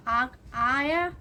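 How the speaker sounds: आ stretched long and slow, then आया; teacher stretches आ to show the ending sound; drawn-out aa sound. Shown as a demonstration. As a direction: hold the vowel आ, then say आया. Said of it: "आ की ध्वनि निकली".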